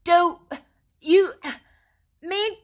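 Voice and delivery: high, loud, word-by-word cry; can't believe he doesn't get it; excited. A narrator's reading. Rat presses Mole about the doormat, excited. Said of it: "cried the excited rat".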